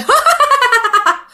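high spooky ha ha ha cackle; vengeful ghost's laugh; eerie laugh. Retold or imitated. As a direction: cackle like a possessed man. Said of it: "Bắt đầu cười hả hả hả lên".

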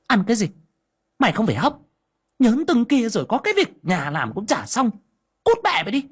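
high, harsh scolding yell; shouting furiously. Acted. Stepmother screaming at the little girl, furious. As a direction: snap at the girl with contempt. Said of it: "trợn mắt lên quát".

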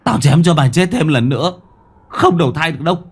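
furious shout. Acted. Thượng screaming threats at the ghost at the door. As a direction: drive the ghost away with rage.

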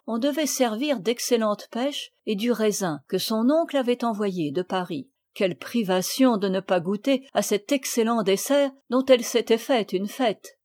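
plain reading voice

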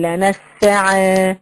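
"لنسعا" slow, ending on a long alif; stop with alif. Shown as a demonstration. Teacher models the correct stop: "لنسعا" with long final alif. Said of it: "لازم أقف بالألف".

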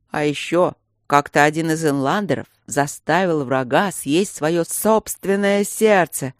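howled slow and eerie; creepy butler. Acted. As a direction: wail it out like a ghost. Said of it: "провыл Бернард". (завывающий жуткий голос)

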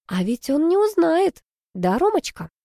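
high, slow sing-song coaxing; wheedling. A narrator's reading. Sister securing brother's silence about dad's car, wheedling. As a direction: coax him sweetly, stretching it out.

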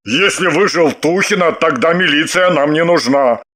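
lightly voiced man, explaining; husband character. A narrator's reading. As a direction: reason with the women as the husband. (мужской персонаж, объясняет)

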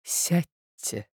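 soft, subdued request; sad. A narrator's reading. Marya points him to a chair, sadly. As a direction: ask it quietly, with sorrow. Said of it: "грустно проговорила она".

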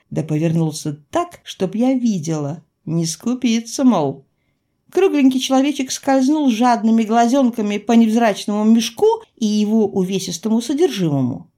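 ordinary delivery

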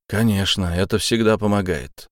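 ordinary delivery

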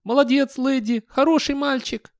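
very high-pitched, excited doggy voice; ecstatic dog. A narrator's reading. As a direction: squeal it like an overjoyed dog. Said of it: "Восторженный заливистый лай".

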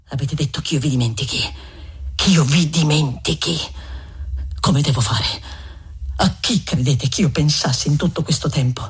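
a wounded lover insisting he won't forget; Renzo indignant. Acted. Reader voices Renzo, aggrieved.

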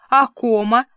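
a loud, eager cry of a point scored; eager exclaim. Acted. Milka triumphantly challenging her friend, eager exclaim. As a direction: shout it out like a clever gotcha. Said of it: "азартно воскликнула Милка".

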